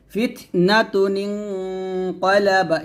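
ফিতনাতুন নিনকলাবা recited slowly, single pesh joined by noon qutni; slow recitation. Shown as a demonstration. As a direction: chant ফিতনাতুন নিনকলাবা in tajweed style. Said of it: "এরকম". (ধীরে তিলাওয়াত করে পড়া)

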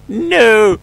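high, loud cry of dismay; panicked shout. Real, not acted. Trapped player shouts in panic at being stuck. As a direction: yell as the trap closes.

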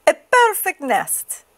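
high, drawn-out chicken exclamation; excited cry. A narrator's reading. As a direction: marvel aloud, slow and high. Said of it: "she cried".

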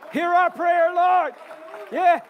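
shouting prayer from the pulpit; fervent loud prayer. Real, not acted. Pastor cries out in prayer.

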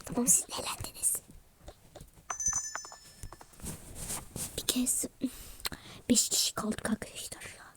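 soft unvoiced whisper, fading away; late-night whisper. Real, not acted. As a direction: keep it hushed so nobody wakes. Late night, everyone asleep.

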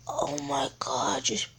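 hushed, low whisper; whispered awe. Real, not acted. Gamer marvelling at the game, whispered awe. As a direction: whisper it under the breath.

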